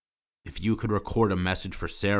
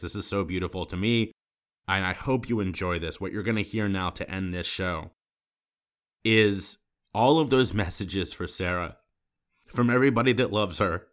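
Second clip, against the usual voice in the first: ordinary delivery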